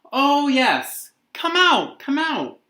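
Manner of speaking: very high, loud distant calling; faraway call. Acted. Stillwater calling Addie out to the backyard from afar. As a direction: call from far away in the yard.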